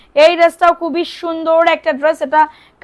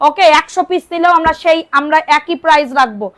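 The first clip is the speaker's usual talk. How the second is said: usual speaking voice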